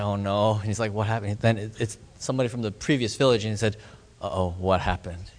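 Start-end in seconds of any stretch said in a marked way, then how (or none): 0.0-1.4 low, soft dread; retold hushed worry, voice dropped; scared. Preacher takes a phone call from the village, scared. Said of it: "he's just shaking". 4.2-5.3 low, soft uh-oh of dread; retold worry in a small, dropped voice; scared. Preacher hears from the previous village, scared. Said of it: "'cause he's so scared".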